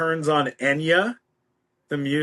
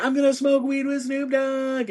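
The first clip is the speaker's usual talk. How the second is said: high-pitched giddy announcement; retold giddy excitement; 9 semitones higher than usual. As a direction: act out breaking thrilling news to mom, way up high. Retells excited call to mom.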